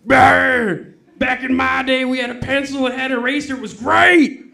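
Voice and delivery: put-on high, loud old-timer ranting; cranky old man. Customer mocked as an old-timer ranting about apps, high and loud. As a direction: rant like a grumpy grandpa about the past.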